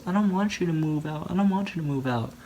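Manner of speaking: mock pleading. Rebecca begging her roommate not to move out, mock pleading. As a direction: act out begging her to stay.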